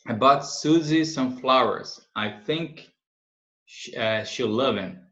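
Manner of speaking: slow, careful example sentence; slow model reading. Shown as a demonstration. Teacher reading the example slowly to students. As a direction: read it slowly for the class to follow. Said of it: "slow model reading".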